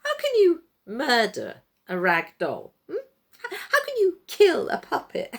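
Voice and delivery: loud, sneering, rising scoff; scornful mockery. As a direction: ridicule the fuss. Puppet master mocks crowd.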